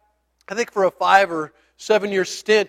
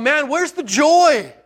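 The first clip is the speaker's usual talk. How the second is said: bewildered; 8 semitones higher, slower than usual. Retold or imitated. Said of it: "I sat back going".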